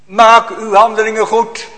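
loud, high prophetic cry, slowed; Jeremiah's slow, loud cry to the temple crowds; prophet calling out. Acted. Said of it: "Dan roept hij".

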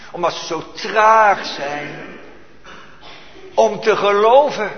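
loud, slow, emphatic sermon voice; full force preaching. Acted. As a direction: hammer each word home, slowly and loudly. (krachtig en langzaam gepreekt)

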